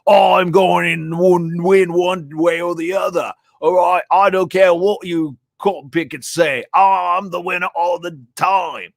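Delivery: lightly voiced Aussie Trump boasting; mock Aussie Trump. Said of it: "If he did, he'd be like".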